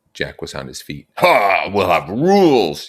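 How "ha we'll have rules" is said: loud, eager, drawn-out outburst; excited cry. A narrator's reading. Jack leaping up at the meeting, excited and loud. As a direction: burst out with glee. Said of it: "he cried excitedly".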